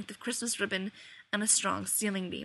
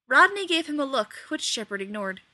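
ordinary delivery

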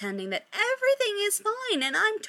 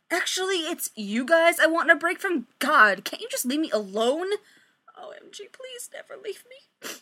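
push-away act; 6 semitones higher, 3 dB louder than usual. Acted. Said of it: "she tries the whole".

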